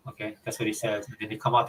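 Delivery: ordinary talk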